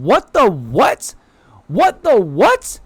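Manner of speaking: slow, high and loud; stunned disbelief. Real, not acted. Stunned by the villain's confession, slow and disbelieving. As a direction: drag it out in disbelief.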